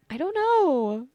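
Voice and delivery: high, slow, drawn-out sleepy sigh; sleepy drawl. Real, not acted. Podcaster trailing off sleepily after sharing something vulnerable. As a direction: let the words sag out, half asleep. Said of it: "I'm still sleepy".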